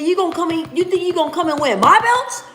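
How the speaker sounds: high, rising challenge; Ryan Garcia taunt. Retold or imitated. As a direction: dare him to take the belts. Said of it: "He said".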